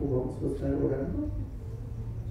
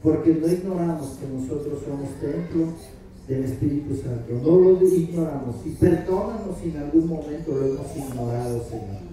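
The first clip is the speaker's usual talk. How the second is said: fervent prayer; 3 semitones higher, 5 dB louder than usual. Acted.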